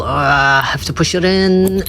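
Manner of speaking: strained, rising voice of a struggle; straining with effort. Real, not acted. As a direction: push the words out slowly as you shove. Pushing keychain part in, struggling.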